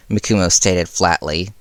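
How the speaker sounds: plain reading voice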